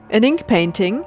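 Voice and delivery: plain reading voice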